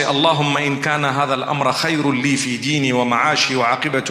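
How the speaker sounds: Arabic dua recited at double speed; very fast recitation. Shown as a demonstration. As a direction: run through the memorized supplication quickly and evenly. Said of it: "I know I said it very fast".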